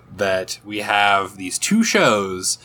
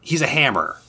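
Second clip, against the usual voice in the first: usual speaking voice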